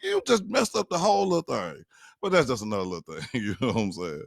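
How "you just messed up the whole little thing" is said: much higher complaint; husband telling wife she spoiled the prank, higher; exasperated. Retold or imitated. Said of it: "be just like".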